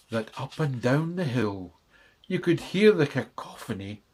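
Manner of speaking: usual speaking voice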